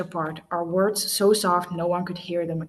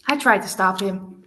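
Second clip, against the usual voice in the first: ordinary delivery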